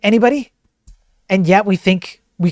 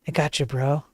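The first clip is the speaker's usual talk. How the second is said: hushed, soothing sycophant whisper; mock AI whispering agreement; whispered chatbot voice; 5 semitones lower, 10 dB quieter than usual. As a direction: breathe it like a fawning yes-man bot. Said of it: "sycophantic L L M is like".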